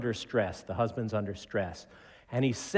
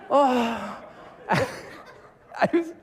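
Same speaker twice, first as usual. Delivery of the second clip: laughing; 12 semitones higher, 3 dB louder, much slower than usual. Real, not acted. Laughing at the scene.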